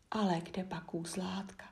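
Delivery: plain reading voice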